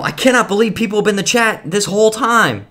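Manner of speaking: high, amazed disbelief; can't believe it, real shock; surprised. Real, not acted. Discovers chat was watching.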